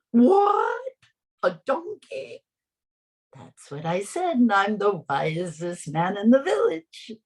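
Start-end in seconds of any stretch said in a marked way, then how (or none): 0.1-2.4 squeaky, much higher disbelief; can't believe the advice; astonished. Acted. Isaac shocked at advice.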